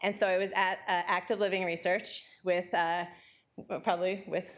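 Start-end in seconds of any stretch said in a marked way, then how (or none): none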